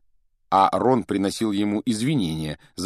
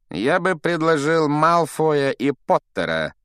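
slow, higher, insinuating professor; sly suggestion; 7 semitones higher, 4 dB louder than usual. A narrator's reading. Professor picking two rivals for the duel, sly and slow. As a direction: propose it slyly, drawing the words out. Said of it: "коварно усмехнулся Снегг".

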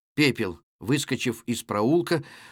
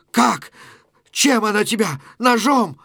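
high, loud urgent questions; alarmed questioning; 7 semitones higher, 5 dB louder than usual. Acted. Pepel asking Natasha how she was hurt, alarmed. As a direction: demand answers in alarm.

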